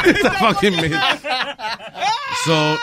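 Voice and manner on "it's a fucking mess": slow, laughed-through repeat; helpless laughter in the words; laughing hard. Real, not acted. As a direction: repeat it while cracking up. Repeats Speedy's line while cracking up.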